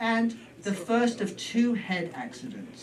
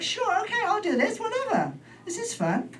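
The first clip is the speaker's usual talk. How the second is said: much higher, laughing mock cheer; mock cheerful. Patient humouring the doctor's stitching music, high mock cheer. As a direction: chirp it up high, giddy and game.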